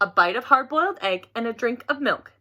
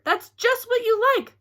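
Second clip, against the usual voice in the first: put-on 1 boy's voice; 12 semitones higher than usual. A narrator's reading. Albert teasing Frances.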